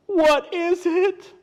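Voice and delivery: high, loud, slow animal voice; scared storybook critter; frightened question. Acted. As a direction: ask it drawn out in mock dread. Animals ask about the pursuer.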